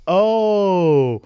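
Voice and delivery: high, loud little oh; surprised oh. Retold or imitated. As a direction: let it land like mild surprise. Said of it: "He's like".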